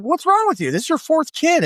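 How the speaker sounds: a nurse mock-scolding a dad; incredulous nurse. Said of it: "And they're like".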